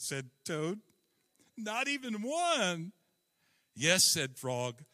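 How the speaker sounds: lively children's story read aloud, characters lightly voiced. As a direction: read it like a bedtime story to grown-ups. Reads Frog and Toad story.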